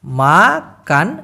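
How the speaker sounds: Makaan drawn out very slowly as maa... kaan; broken down. Shown as a demonstration. Teacher breaking the word up for learners, slow syllables. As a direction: split Makaan into two long syllables. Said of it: "আমি একটু ভাঙায় দিই".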